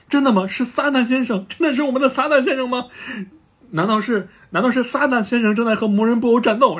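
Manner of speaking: high, loud excited questioning; amazed disbelief. Retold or imitated. Earth's people asking if it's really Satan, amazed.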